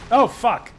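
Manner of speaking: sudden loud high yelp of fright; startled shout. Real, not acted. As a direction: jump out of your skin and swear. Jump scare by skeleton.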